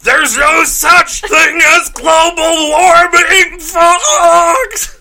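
high, slow shouted preaching; boomer boss rant. Pretend. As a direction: boss fight tirade, yelled. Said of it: "that'd be a great boss".

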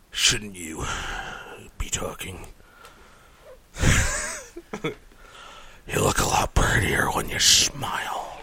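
low breathy creep whisper; creepy whisper. Pretend. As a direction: lean in and creep them out. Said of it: "The Christmas creep".

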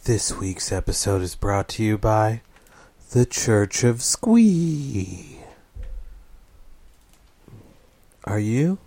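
sponsor ad read. Pretend. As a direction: sell it like a radio commercial. Mock ad read.

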